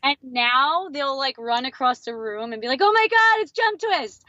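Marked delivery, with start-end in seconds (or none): 2.8-4.2 starstruck fan. Retold or imitated. Fans rushing across the room to meet him, excited squeal. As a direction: gush like a fan who spotted a star.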